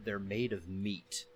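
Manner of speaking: low, slow deadpan line; deadpan film quote. Retold or imitated. Reciting short film dialogue.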